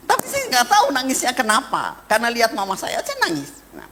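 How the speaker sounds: usual speaking voice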